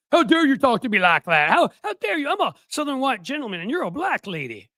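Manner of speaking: high, loud hammed-up outrage; mock-offended outburst, pitch soaring; mock losing it. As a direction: play an indignant Southern gentleman blowing up. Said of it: "He lost it".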